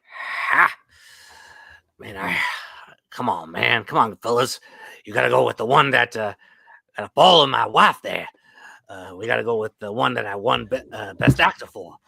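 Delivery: bragging aging movie star; boastful actor voice. Retold or imitated. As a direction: brag like a vain old star. Speaker voices an aging award-winning actor.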